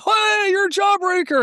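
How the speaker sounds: excited high shout of recognition; fans calling out. Retold or imitated. Fans at a car show shouting out in excitement. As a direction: call out to him like a starstruck fan. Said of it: "Everybody's coming up going".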